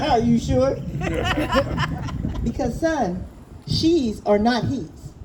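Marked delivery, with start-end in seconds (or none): 0.0-0.8 amused father. Acted. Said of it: "Baba looked at his son and said". 0.8-2.4 amused chuckle. Acted. Baba laughing at his son's wish.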